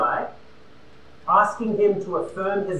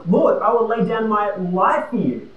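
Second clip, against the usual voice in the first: confident declaration; 4 semitones higher, 6 dB louder than usual. Acted. Peter voiced, bold and sure.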